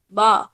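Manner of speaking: बा said alone, loud and punched; loud teacher's बा; syllable demo. Shown as a demonstration. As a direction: call out the syllable बा clearly and loudly. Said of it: "जैसे इसमें पिए पा".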